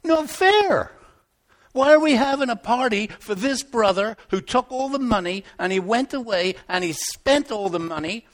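indignant brother. Acted. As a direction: complain like a jealous older brother. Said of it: "What do you think he thought?".

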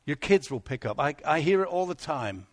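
normal talking voice

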